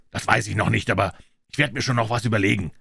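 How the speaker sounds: usual speaking voice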